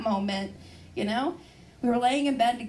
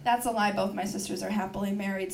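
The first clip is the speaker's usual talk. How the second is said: ordinary talk, nothing marked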